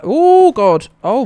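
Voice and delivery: sudden high loud yelp of fright; startled yelp. Real, not acted. Streamer jolted by a game scare, yelping high and loud. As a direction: jump out of your skin.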